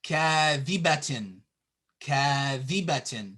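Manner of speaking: kadhibatin with a long first a; long vowel. Shown as a demonstration. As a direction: hold "kaa" in kadhibatin. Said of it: "it's kadhibatin".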